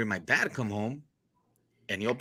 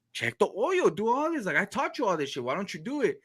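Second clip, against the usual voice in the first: higher, exasperated father's lecture; scolding dad; 10 semitones higher than usual. Retold or imitated. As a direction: nag him like a fed-up father. Dad lecturing careless son.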